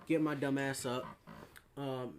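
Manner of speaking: normal talking voice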